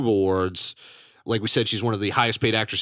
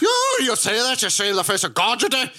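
put-on Irish accent parody; 12 semitones higher, 3 dB louder than usual. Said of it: "Peter O'Toole having a stroke".

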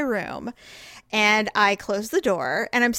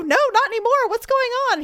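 high, wound-up mock whimper; high-pitched imitation of being upset; tearful retelling; 12 semitones higher, faster than usual. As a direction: answer as if just done crying. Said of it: "Are you crying?".

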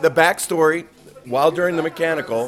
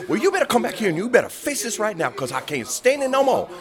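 much higher, indignant complaint; upset passenger; 9 semitones higher than usual. Retold or imitated. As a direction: insist that the purser deal with it now.

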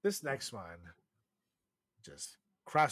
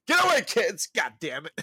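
mock yell; 7 semitones higher, 9 dB louder than usual. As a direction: shout high and loud at the kids. Shooing babies away, yelling.

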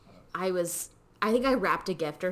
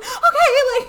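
much higher, louder laughing "Okay"; 12 dB louder than usual. Retold or imitated. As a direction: giggle it out as a relieved kid. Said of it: "I was like".

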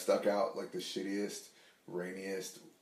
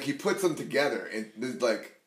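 ordinary talk, nothing marked

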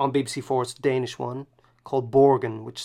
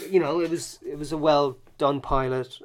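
ordinary delivery

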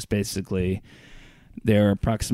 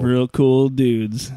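drawn-out, louder sarcastic echo; 3 semitones higher, 5 dB louder, much slower than usual. Real, not acted. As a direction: stretch it out in mock admiration. Sarcastic echo of co-host's remark.

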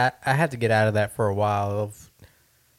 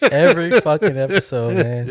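louder, laughing exasperation; 4 semitones higher, 6 dB louder than usual. Real, not acted. Laughing at the sneeze again.

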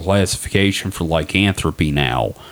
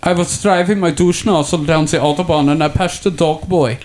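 put-on German accent; 8 semitones higher than usual. Continues German accent bit.

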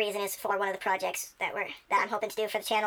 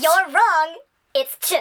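loud, high, triumphant buzzer-style correction; mock game-show correction; 7 semitones higher, 9 dB louder, slower than usual. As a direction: cut in loudly with the right answer. Mock quiz show wrong answer.

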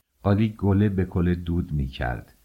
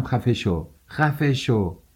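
insistent order, climbing higher on the repeat; angry command; 5 semitones higher, slower than usual. A narrator's reading. Daughter-in-law silencing screaming mother-in-law, escalating angry command. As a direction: say it firmly, then pitch the repeat well up.

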